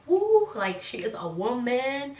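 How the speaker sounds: mock impressed catcall. Imitating viewers impressed by her curves, hyped. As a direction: hype it like a cheering friend. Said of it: "I'm like".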